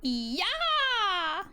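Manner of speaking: high excited yeah; excited cheer. Real, not acted. Sudden cheer while drawing.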